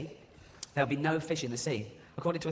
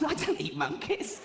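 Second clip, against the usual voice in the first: high, slow, squeaky protest; indignant denial; 11 semitones higher, slower than usual. Acted. Accused party denies eating monkeys, indignant. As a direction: protest it slowly in a comic squeal.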